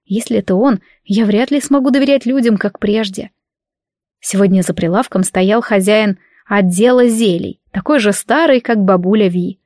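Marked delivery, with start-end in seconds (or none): none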